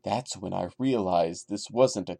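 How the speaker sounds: low, hushed creepypasta reading; story reading. Acted. Reading the Sonic creepypasta aloud to viewers, hushed and low. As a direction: read the creepy tale in a low, even hush.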